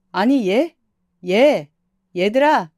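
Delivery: loud calls after them; calling out. Acted. 떠나는 아들 부부를 불러 세우려 크게 부르는 목소리. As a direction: call them back as they leave.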